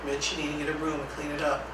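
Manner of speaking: quiet, even, unhurried instruction; very calm voice. Shown as a demonstration. Staff redirecting a challenging student, calm and quiet. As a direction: calmly repeat the directive without rising to it. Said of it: "in that very calm voice".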